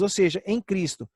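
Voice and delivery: ordinary talk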